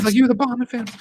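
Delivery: very high, excitable character voice; imitated fan shout-out. Podcasters echoing a favorite movie line, high mock cheer. Repeats the impression of Jay.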